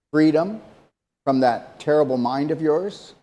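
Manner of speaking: ordinary talk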